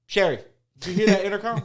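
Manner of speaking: high, loud imitation of urgent questioning; acted agitated shout; calling out. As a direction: call across to a coworker.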